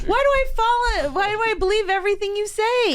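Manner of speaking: high-pitched exasperated protest. Real, not acted. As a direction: wail it at yourself for being fooled. Fooled by his invented fact.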